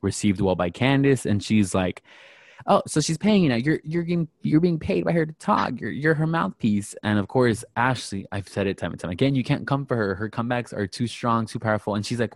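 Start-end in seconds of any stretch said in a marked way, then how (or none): 2.7-6.9 high-pitched taunting retort; mock accusing. Retelling the jab at the friend defending Monique, mock accusing. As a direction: needle her as a paid mouthpiece, pitched up. Acted accusation in retold quarrel.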